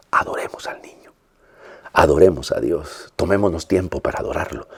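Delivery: very soft, reverent near-whisper; hushed exhortation. Real, not acted. Exhorta con recogimiento a adorar. (exhortación recogida, casi susurrada)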